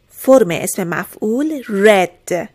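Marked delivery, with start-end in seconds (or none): 1.6-2.5 "read" with a short e, like "red"; past participle pronunciation. Shown as a demonstration. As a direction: say "read" to rhyme with "bed", slowly.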